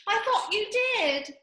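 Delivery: high, pointed squeak; mouse. A narrator's reading. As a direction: make it a small reproach. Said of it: "said the mouse".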